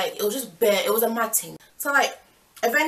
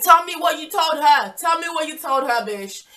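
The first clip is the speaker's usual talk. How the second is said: higher and louder, hammering the demand; retold angry demand; 7 semitones higher, 7 dB louder than usual. Confronting the cheating boyfriend on the phone, shouted demand. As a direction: push him hard for an answer, dropping to a spat insult.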